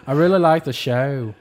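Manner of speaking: hint of a botched British-Australian accent; mixed English-Australian accent. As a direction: blend a Liverpool and an Aussie accent. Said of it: "He's gonna try to mix the two".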